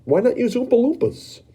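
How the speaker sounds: put-on a kid who thinks he knows better; Mike pressing. Mike challenging Wonka, high and pushy.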